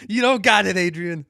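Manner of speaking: high, very loud playful bellow; big put-on yell; mock shout. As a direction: holler it like a hammed-up movie line. Egging co-host on, mock movie shout.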